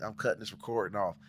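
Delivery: normal talking voice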